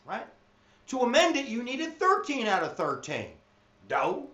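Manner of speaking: usual speaking voice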